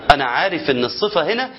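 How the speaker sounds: ordinary talk, nothing marked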